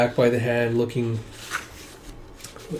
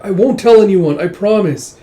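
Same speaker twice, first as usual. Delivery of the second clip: high, louder acted pleading; pleading promise; 7 semitones higher, 10 dB louder than usual. Woman promises Prince Il secrecy, urgent pleading.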